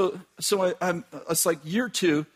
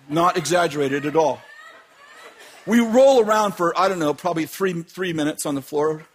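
normal talking voice

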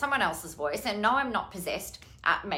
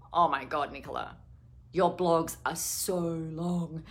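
lower, slow, lightly voiced husband complaining; mock husband voice; 4 semitones lower, 4 dB quieter than usual. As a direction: drag it out like a teasing husband. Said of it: "And he goes".

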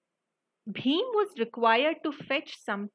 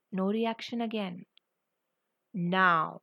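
plain reading voice

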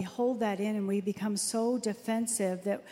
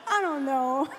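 higher-pitched, helpless laughter through the words; laughing; 4 semitones higher than usual. Real, not acted. Wife giggling at her own made-up sarcastic comeback, laughing. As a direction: crack up while trying to speak. Cracks up at own failed joke.

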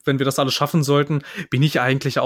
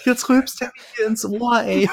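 laughing; 8 semitones higher, slower than usual. Real, not acted. Laughing at partner's burp.

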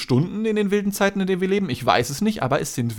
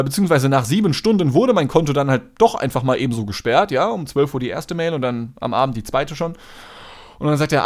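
usual speaking voice

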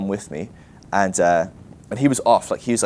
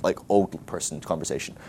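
normal talking voice

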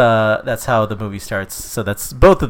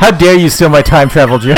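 loud, higher mock indignation; mock outrage; 4 semitones higher, 8 dB louder than usual. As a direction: play-act being outraged at a rival. Mock quarrel over a stolen joke.

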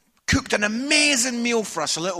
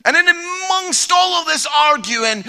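loud, higher voice building to a near-shout; worked-up, shouted storytelling; re-enacted shouting match; 5 semitones higher, 7 dB louder than usual. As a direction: retell the family row as it escalates, louder and louder. Re-enacts escalating family shouting match.

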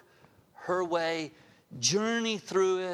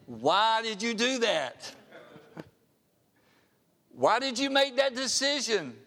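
raised voice of a disappointed God; God's question; 6 semitones higher, 4 dB louder than usual. Pretend. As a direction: call the question out as God scolding. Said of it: "He might be saying".

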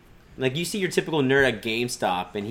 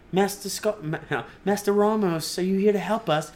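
put-on child's voice; 7 semitones higher than usual. Voicing younglings begging.

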